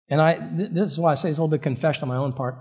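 ordinary delivery

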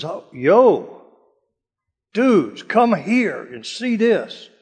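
slow, drawn-out mock surfer king; casual dude king. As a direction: ham up a laid-back king calling his buddies. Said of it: "That's the way they talked".

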